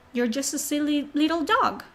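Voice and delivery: ordinary talk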